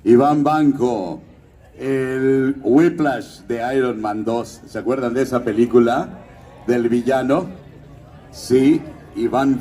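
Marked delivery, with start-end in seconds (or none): none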